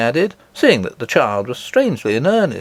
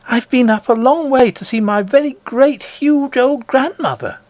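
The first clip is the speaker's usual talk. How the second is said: put-on an eager child telling a wonder; little princess; 13 semitones higher than usual. Princess telling nurse of her grandmother, high and eager.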